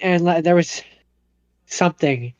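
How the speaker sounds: normal talking voice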